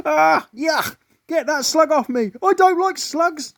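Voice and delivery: high, loud squeal of disgust; disgusted lady. A narrator's reading. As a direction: shriek at the slimy slug. Woman disgusted by slug.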